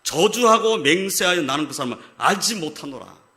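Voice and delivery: forcefully quoted oath; cursing, sworn denial. Retold or imitated. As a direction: drive home Peter's retold denial. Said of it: "베드로는 저주를 했다는 겁니다".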